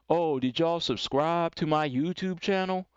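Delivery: mock a timid YouTuber fishing for subscribers; drawn-out, tentative asking; passive asking. Shown as a demonstration. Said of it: "Don't be sitting here asking all these questions".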